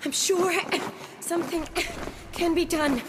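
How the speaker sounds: high, broken-up insistence; desperate, pitched high in short bursts; frantic. Acted. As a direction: refuse to give up on the patient. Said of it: "calm down".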